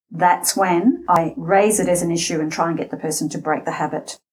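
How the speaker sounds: ordinary talk, nothing marked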